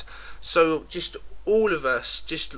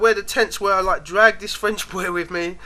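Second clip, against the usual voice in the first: ordinary talk, nothing marked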